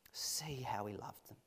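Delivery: hushed unvoiced whisper. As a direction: breathe it like a secret.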